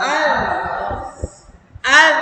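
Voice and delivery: আজ with the final z held, no bounce; held final consonant. Shown as a demonstration. Teacher shows students there is no qalqala, held steady. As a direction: hold the end of আজ without an echo. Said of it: "ধরে রাখতে হবে".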